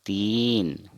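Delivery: तीन as one long syllable; Hindi pronunciation. Shown as a demonstration. Teacher giving the Hindi three, long single syllable. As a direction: stretch the ee of तीन, end on n. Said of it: "Hindi में हम इसको बोलेंगे".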